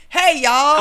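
mock Southern. Answering what Americans say, loud mock Southern greeting. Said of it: "What's our saying?".

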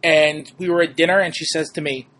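ordinary delivery

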